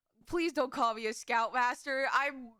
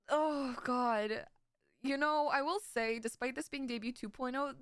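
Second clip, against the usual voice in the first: normal talking voice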